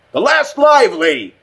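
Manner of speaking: high, loud call in a different voice. A narrator's reading. Richardson calls out toward the troll's overpass, loud. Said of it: "called out in a louder, different voice".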